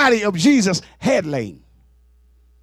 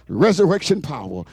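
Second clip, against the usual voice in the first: normal talking voice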